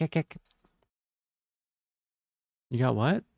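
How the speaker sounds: ordinary talk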